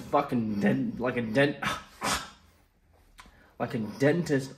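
stumbling on "dentist", breaking off at "dent"; struggling to say D. Real, not acted. Numb-mouthed patient telling the story, struggling with "dentist". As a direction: restart "dentist" with a sigh, fighting the numb D. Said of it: "I can't say D".

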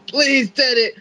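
high, loud begging; imitated wail of a man begging; mock screaming plea. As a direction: plead desperately for your job, hammed-up. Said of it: "just starts screaming at Teddy Long".

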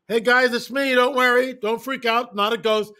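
calling out. Retold or imitated. Jesus walking on water calling out to the disciples, loud cheerful call. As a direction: shout cheerfully to the boat. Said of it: "He's walking on the water".

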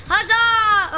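high triumphant shout; excited cheer. Real, not acted. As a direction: cheer it out loud.

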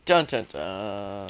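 slow, low dun dun dun, like a suspense sting; dramatic dun dun dun. Pretend. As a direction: hum the dramatic drumroll, slowly. Dramatic sound effect sting.

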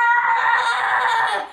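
a loud, high, frightened scream. Acted. Boy waking to a giant eyeball, high loud scream. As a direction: shriek in terror. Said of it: "that screamed Pip".

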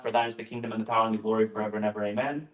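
quick, low run-through of a memorized prayer; rote recitation. Shown as a demonstration. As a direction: rattle it off fast and low.

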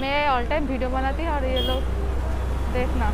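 muffled by cloth mask; speaking behind fabric covering; through a face mask. Real, not acted. Seen 1: Checked cloth mask covers her mouth and nose throughout.

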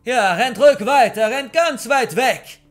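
full-force far-carrying shout; shouting after them. A narrator's reading. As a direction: yell it after fleeing people. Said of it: "er schrie uns noch hinterher".

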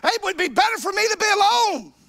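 high, loud, shouted sermon climax; hollering revival preacher who won't give an inch; preaching at full force. Acted. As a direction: whip the congregation up, pitch rising, voice at full stretch.

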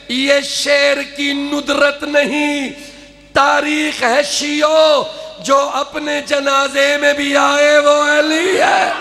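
slow, called-out verse; rousing declamation. Acted. Poet rousing the Shia audience, calling out. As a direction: call it out to stir the Shias. Climax of the couplet.

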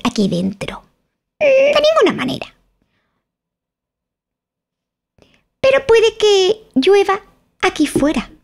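peer out from under the umbrella, prim and odd; odd twin. Acted. Tweedledum under his umbrella answering Alice, very high and strange.

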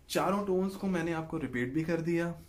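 normal talking voice

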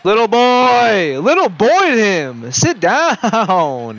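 roast the defender at full voice; taunting yell. Real, not acted.

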